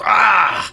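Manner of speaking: high, loud acted cry of pain; impaled pain scream. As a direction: scream as if run through by a sword. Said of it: "impales himself onto Merrick's sword".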